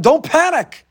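high, panicked cry; imitating a frantic shout; mock yell. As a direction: yell it in mock alarm, pitch shooting up. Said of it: "are yelling".

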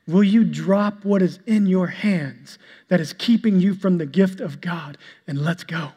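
ordinary talk

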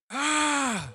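high "ah" cry imitating a frenzied fan; fan losing it. Preacher mimicking a guy losing it, high cry. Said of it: "this guy is just losing it".

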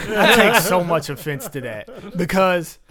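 playfully offended while laughing. Real, not acted. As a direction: protest through real laughter. Mock offense after a joke.

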